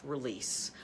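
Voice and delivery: low, soft single-word release; soft command to self. Shown as a demonstration. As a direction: let the word fall away softly. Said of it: "just say to yourself".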